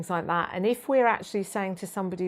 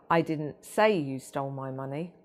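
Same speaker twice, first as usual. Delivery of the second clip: "say" raised and punched; stress on SAY; 3 semitones lower than usual. Shown as a demonstration. Trainer shows how tone shifts meaning, stress on "say". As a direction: lean on the word "say". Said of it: "spoken in several different ways".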